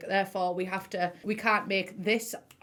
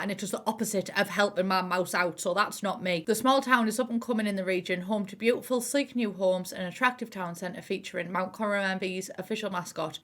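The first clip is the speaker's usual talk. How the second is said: ordinary delivery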